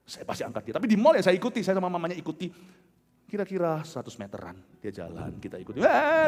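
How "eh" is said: loud, high mock toddler wail; imitated crying. Father retells child crying when followed, mock wailing. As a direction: burst into a pretend child's cry.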